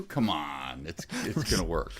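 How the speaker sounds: ordinary talk, nothing marked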